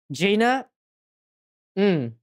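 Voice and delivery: ordinary talk, nothing marked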